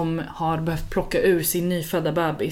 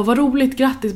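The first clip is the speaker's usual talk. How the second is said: higher, delighted congratulations to a new parent; bright excited greeting; 6 semitones higher than usual. Retold or imitated. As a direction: gush over the baby, pitched up. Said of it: "och jag bara".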